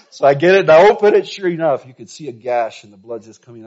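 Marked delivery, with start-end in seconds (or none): 0.0-1.8 laughing through the words, louder; cracking up while telling it; real laughter breaking into the line. Real, not acted. Laughing while retelling the story.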